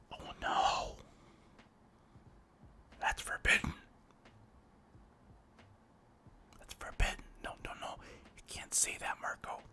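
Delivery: mock fearful hush; scandalized whisper. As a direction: whisper like someone might overhear. Warning himself about the taboo.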